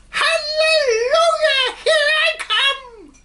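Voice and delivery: put-on shrieking old hag's whoop, very high and loud; cackling witch-like cry of glee; old hag shrieking with delight; screeching shout. A narrator's reading. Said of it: "finding her voice at last".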